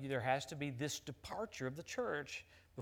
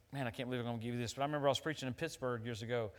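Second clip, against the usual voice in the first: normal talking voice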